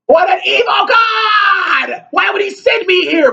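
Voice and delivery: high, wailing mock cry of the damned; mock anguished wail. Vlogger mocks a damned liberal, wailing high. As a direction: shriek it like a soul in hell. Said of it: "you're gonna be like".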